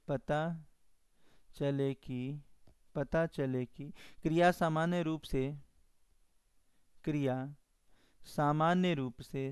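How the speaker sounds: slow, soft dictation with long pauses between phrases; feed the definition piece by piece so they can write. Acted. Teacher dictating a grammar definition to the class, slow and halting.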